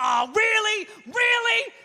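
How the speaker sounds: high, mock-furious disbelief; exasperated outburst. Owner berating a crashed computer, high exasperated outburst.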